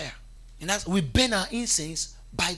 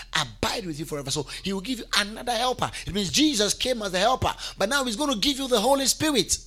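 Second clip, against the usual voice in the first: normal talking voice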